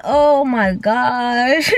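very slow, stretched-out exasperation; exasperated groan. Retold or imitated. Aggravated by the noise, drawn-out exasperation. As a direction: drag every word out in aggravation.

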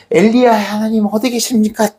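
high, insistent call to God; acted exasperated cry. Elisha striking the water, acted exasperated cry. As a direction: demand an answer in acted frustration.

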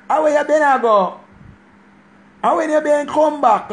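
high childlike voice, mock innocent; imitating a little girl, pitched high. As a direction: play yourself as a small Jamaican girl asking. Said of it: "me when a little girl I say".

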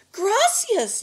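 high, loud desperate call; yell. A narrator's reading. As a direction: yell the name after someone running away. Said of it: "yelled Miguel".

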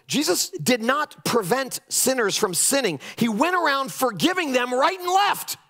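ordinary talk

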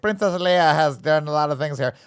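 mock briefing officer botching "Leia"; imitation of someone mangling "Leia"; mispronounced Leia. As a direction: stumble pompously over "Leia" as if unable to pronounce it. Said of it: "a guy who can't pronounce Leia".

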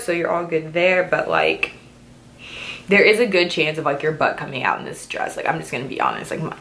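usual speaking voice

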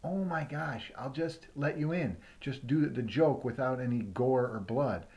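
ordinary delivery

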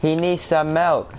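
slow, drawn-out meme quote; demonstrated meme line. Shown as a demonstration. Showing a friend how to say it, slow meme delivery. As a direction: stretch it out, word by word. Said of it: "Say it like that".

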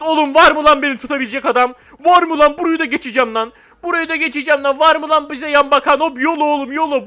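loud, hyped boasting; pumped up. Real, not acted. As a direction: challenge everyone, fired up. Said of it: "Bayağı bir gaza geldim".

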